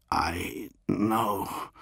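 wet, gurgling voice. A narrator's reading. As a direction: speak through a throat full of liquid. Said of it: "the man gurgled".

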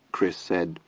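plain reading voice

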